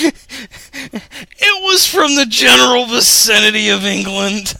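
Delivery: high, loud, cracking up; laughing protest. Real, not acted. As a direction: defend yourself helplessly through laughter. Defends botched accent while cracking up.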